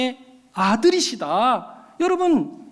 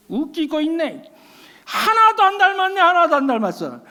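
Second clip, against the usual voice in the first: mocking kid; 6 semitones higher than usual. Kids mocking a classmate.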